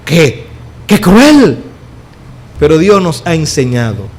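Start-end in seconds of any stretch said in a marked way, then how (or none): 0.0-1.6 a touch of indignant cry; mock outrage. Critics complaining about strictness, mock indignant cry. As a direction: play the offended critic. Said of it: "y entonces dicen".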